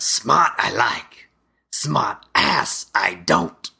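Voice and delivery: slow, drawn-out mob boss impression; Luigi Petrovita. Mob boss sizing up a tough guy, slow mock-menacing delivery. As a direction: weigh out each word like a tough mobster. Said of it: "Italian mob boss".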